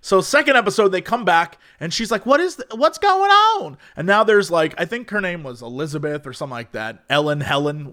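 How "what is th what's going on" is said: put-on high-pitched, bewildered Southern old lady. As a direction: imitate a confused Southern granny, pitched way up. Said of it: "old Southern lady who's just".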